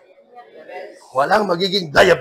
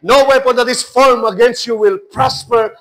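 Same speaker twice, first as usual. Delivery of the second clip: loud, high preacher's proclamation; preaching at full force; 4 semitones higher, 6 dB louder, much slower than usual. Acted. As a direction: preach it out, building.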